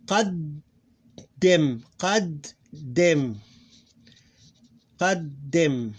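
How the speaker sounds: qaddim broken into qad, a held gap, then dim; two syllables. Shown as a demonstration. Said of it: "two syllables".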